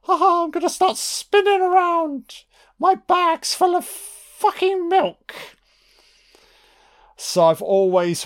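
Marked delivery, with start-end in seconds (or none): 0.0-5.3 very high, manic sing-out; possessed woman. Acted. Possessed woman announcing her frenzy, hammed-up high crazed voice. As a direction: shriek it gleefully like someone deranged, far above normal pitch. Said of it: "It's possession times fucking a hundred".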